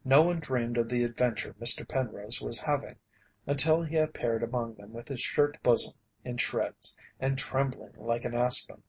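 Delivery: ordinary delivery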